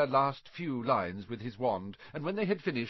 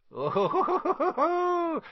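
high cackling laugh on the word; evil cackle; 6 dB louder than usual. A narrator's reading. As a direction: cackle it out wickedly. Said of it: "with an evil cackle".

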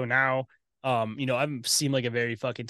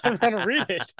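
laughing; 7 semitones higher than usual. Real, not acted. Laughing while joking.

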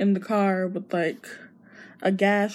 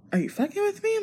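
much higher, incredulous; 12 semitones higher, faster than usual. Retold or imitated. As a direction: throw it out in disbelief. Miguel suspects a scam.

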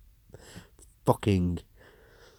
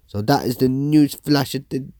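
higher, louder anchor voice; newsreader sign-off; 7 semitones higher, 8 dB louder than usual. Acted. Presenter closing the news flash, anchor voice. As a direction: wrap up the bulletin like a TV host.

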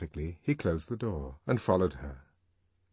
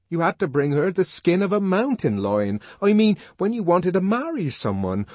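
high, loud, fervent outpouring; impassioned, overwrought explaining; agitated explanation; 10 semitones higher, 7 dB louder than usual. A narrator's reading. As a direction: push it out urgently, high and loud. Savage agitatedly explains his people's custom.

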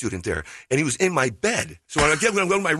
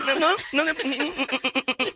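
high mock refusal; 11 semitones higher than usual. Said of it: "mom refusing".

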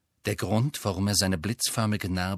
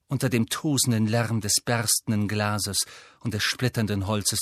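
ordinary delivery

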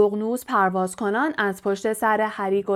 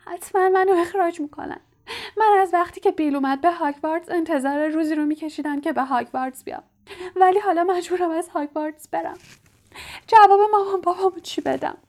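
crying; 8 semitones higher, faster than usual. A narrator's reading. Ginny crying to Harry about expulsion, high wailing. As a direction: wail it out through tears, rising in panic. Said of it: "گریه کنان گفت".

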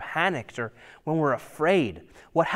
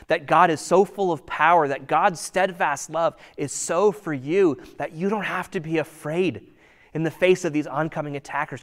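ordinary talk

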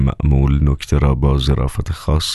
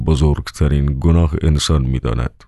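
plain reading voice

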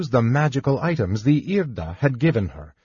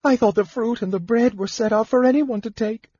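put-on much higher, pleading girlish voice; put-on tears; 11 semitones higher than usual. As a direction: play the innocent through tears. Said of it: "Tears had always worked with Pratt".

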